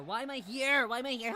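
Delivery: rattled, high-pitched protest; panicked yelling. Real, not acted. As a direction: cry it out in dismay. Streamer panics at the battle.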